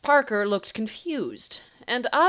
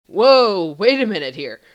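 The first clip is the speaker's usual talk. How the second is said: a much louder whoa, calling for a stop; alarmed shout; 10 dB louder than usual. Acted. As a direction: jump in and halt everyone, loud. Carter steps in to stop fight.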